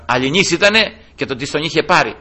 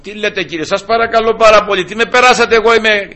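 higher, louder mock indignant lady; hammed-up woman denying her sins; offended proper lady; 8 semitones higher, 5 dB louder than usual. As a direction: play a huffy respectable woman protesting. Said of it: "Αν ήτανε καμιά άλλη, ξέρω εγώ".

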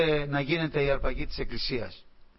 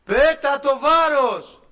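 very high, slow, much louder commanding shout; heavenly shout; 11 semitones higher, 10 dB louder, much slower than usual. Retold or imitated. Voice from heaven shouts at the dreamer, high and loud. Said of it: "Δεύτερη φορά η φωνή".

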